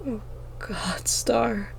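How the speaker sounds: soft breathy relieved murmur; mumbled. A narrator's reading. Girl murmuring to her sister after the nightmare, softly. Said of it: "I mumbled".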